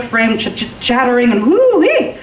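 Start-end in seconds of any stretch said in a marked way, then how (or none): 1.5-2.1 high, stretched-out "woo-wee"; sudden high-pitched holler; wild whoop. A narrator's reading. Frightened slide down shaking scaffold.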